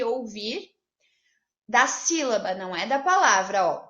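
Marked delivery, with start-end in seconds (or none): none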